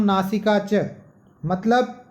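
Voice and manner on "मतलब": usual speaking voice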